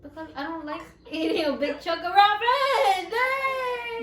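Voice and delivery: whining with a laugh; half-laughing whine; whiny fake cry. Real, not acted. Whining about raw fish.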